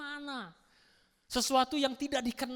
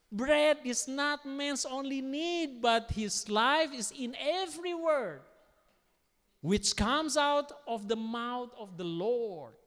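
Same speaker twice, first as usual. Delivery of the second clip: scripture reading; 4 semitones higher, 5 dB louder, slower than usual. Acted. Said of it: "reads scripture".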